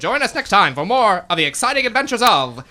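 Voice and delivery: radio announcer sign-off; announcer outro. Acted. Announcer to listeners, closing show sign-off. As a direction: wrap it up like an old radio show.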